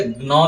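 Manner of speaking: ज्ञ said as "gna"; regional "gna". Shown as a demonstration. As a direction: say ज्ञ as g plus n. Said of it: "ग और न उच्चारण करते हैं".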